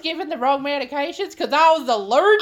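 hammed-up Chicago accent. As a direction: complain in a put-on Chicago accent. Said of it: "Chicago accent".

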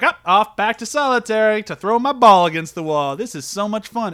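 mock cheery hero. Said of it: "he's like".

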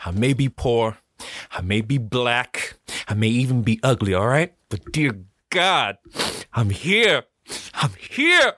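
slow, heartfelt build to a high cry; mean it with your heart, rising on the last I'm here; with passion. Shown as a demonstration. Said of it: "say it with passion".

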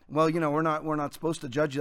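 low, soft mock objection; meek objector. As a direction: mimic a reluctant believer making excuses.

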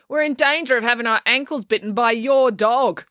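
high, projected complaint; calling out. A narrator's reading. Kieran complaining about April's dog, calling out. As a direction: call it back across the room. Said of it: "called out Kieran from the other side".

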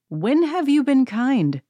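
high, drawn-out mock disbelief; incredulous retort. Everyone answers Anne at once, mock incredulous. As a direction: stretch it slowly and pitch it way up.

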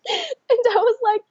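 high laugh-cry. Real, not acted. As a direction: break into laughter while speaking. Laughing at memory.

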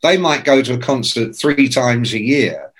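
ordinary delivery

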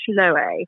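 "Schloe" as shloh-ay, two syllables; mispronounced drink name. Retold or imitated. Recalling what the audience remembers of her talk, mispronounced. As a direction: say "Schloe" with a wrong "-ay" ending. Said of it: "the fact that I said Schloe".

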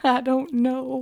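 slow, high mock-tearful voice; phony crying. Listener echoes the confused guest, phony crying. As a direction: drag it out in a fake weep. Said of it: "phony baloney crying".

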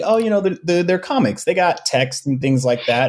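casual assumption. Retold or imitated. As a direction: voice a breezy, taken-for-granted thought. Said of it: "you know, we think".

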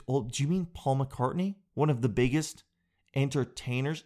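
usual speaking voice

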